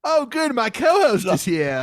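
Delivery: put-on very high, loud, slow posh British accent; mock British accent. Host greeting co-host in the British bit, hammed-up posh British. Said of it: "do the whole segment like this".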